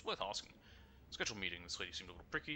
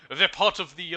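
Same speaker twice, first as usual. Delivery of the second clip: a hint of a Japanese accent, louder; slight put-on accent; 9 semitones higher, 13 dB louder, slower than usual. Describing the Yakuza in a mild Japanese accent. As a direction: lightly slip into a Japanese accent. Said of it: "I did a bit of an accent".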